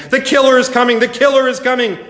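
high, loud alarm call; alarmed warning cry. A narrator's reading. Falling leaves warning the sisters of the killer, alarmed cry.

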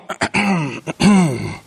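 pointed clearing of the throat; awkward throat clearing. Acted. Uneasy dinner guests reacting to the host's guest, mock throat clearing. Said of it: "there's some people going".

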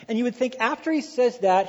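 normal talking voice

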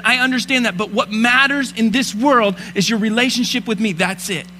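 impassioned Christmas sermon; preaching. Acted. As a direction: preach it with fire to the congregation. Preaching at full force.

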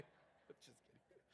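breathy whispered take-back; whispered aside. Real, not acted. Preacher whispering to the congregation that he is joking. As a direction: drop to a whisper.

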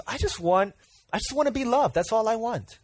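high, yearning plea; high pleading voice, lightly acted; pleading Jacob. Jacob's longing voiced in sermon.

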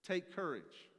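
loud, high, stretched proclamation; booming encouragement. Acted. Preacher voicing Jesus over the storm, booming.

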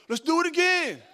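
high, loud, eager plea; eager shout. Retold or imitated. Son asking dad to ride again, excited shout. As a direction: beg to go again, thrilled. Kid begging for another go.

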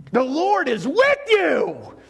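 high, slow, ringing announcement; exclaimed with joy. Acted. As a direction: proclaim it like the best news ever. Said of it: "and there's an exclamation point".

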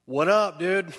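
loud, high, slangy greeting; mock casual God. God greeting Moses like a dude, loud and high. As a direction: bust in like a buddy.

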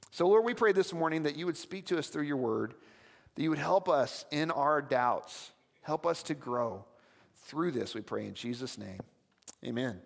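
soft, low, slowing prayer voice. Acted. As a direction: pray it gently and unhurried, fading at the amen. Pastor leads a closing prayer.

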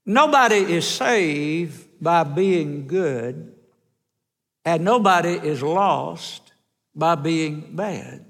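very slow, every word weighed; slow emphatic preacher's point; slow and deliberate. Real, not acted. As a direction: spell it out slowly so nobody misses it. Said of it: "I want you to listen very carefully".

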